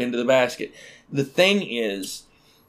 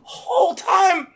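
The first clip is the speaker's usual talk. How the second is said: mock anguished wail; much slower than usual. Realizing his wife was cheating, a mock wail. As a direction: wail it like a wronged man.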